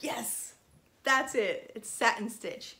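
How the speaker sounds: ordinary talk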